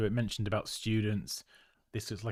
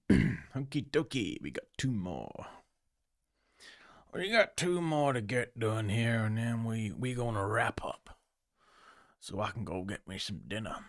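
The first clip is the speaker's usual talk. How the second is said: Arthur Morgan. Acted. Streamer announcing the last two designs before dinner, hammy Texas outlaw impression. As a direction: badly imitate a drawling Texas outlaw. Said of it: "That's my terrible Arthur Morgan impression".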